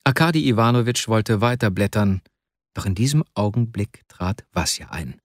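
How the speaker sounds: ordinary delivery, nothing marked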